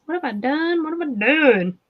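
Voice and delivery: ordinary talk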